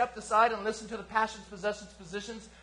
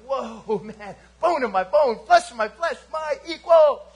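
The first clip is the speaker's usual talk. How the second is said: building to a louder, higher cry of wonder; Adam amazed; 4 semitones higher, 9 dB louder, slower than usual. Acted. Adam first sees his wife and cries out, building wonder. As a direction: let the joy swell up line by line.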